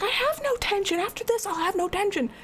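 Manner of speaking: high, exasperated protest; high indignant complaint; exasperated retort. Retold or imitated. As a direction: squeak back at the advice. Retorting to partner's sleep advice.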